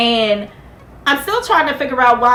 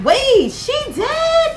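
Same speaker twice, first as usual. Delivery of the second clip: very high and very slow "wait, she did"; shocked disbelief; 13 semitones higher, much slower than usual. Retold or imitated. Viewer hearing the doctor's condolences, high and drawn out. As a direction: stretch it out in stunned disbelief. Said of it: "And I was like".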